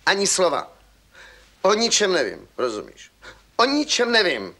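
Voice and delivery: loud, sharp, final; stern refusal. Acted. Gendarme cuts off the pleading boy, loud and stern. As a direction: shut him up firmly.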